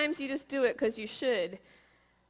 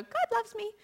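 flippant quote; 11 semitones higher than usual. Retold or imitated. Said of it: "mess up and go".

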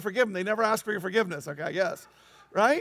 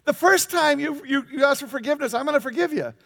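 mock exasperation; 3 semitones higher, 7 dB louder than usual. Counting forgiveness in mock exasperation.

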